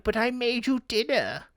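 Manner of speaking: high, wounded aunt's voice; guilt-trip him like a hurt aunt; 1 hurt auntie. Pretend.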